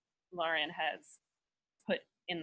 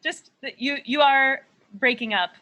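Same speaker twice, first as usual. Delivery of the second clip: louder and higher so it carries; loud repeat; 6 semitones higher, 12 dB louder than usual. Real, not acted. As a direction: raise your voice over the bad line. Repeating after "What was that?.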